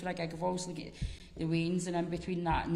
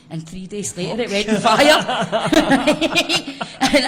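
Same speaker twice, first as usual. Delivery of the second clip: laughing; 4 semitones higher, 16 dB louder, much slower than usual. Real, not acted. Laughing while telling the gig story.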